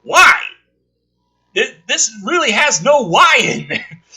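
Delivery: loud, high exasperated "why". Real, not acted. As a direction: throw up your hands at the plot. Exasperated at a plot hole.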